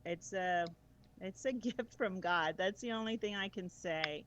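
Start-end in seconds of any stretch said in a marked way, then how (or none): none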